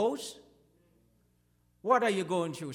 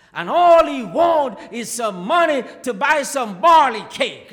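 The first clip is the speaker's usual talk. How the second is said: rising shouted sermon delivery, louder line by line; preaching at full force; 3 semitones higher, 13 dB louder than usual. Acted. Preacher telling the congregation the story, full-force preaching.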